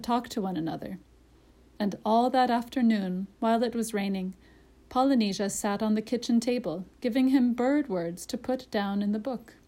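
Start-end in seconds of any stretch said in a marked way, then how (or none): none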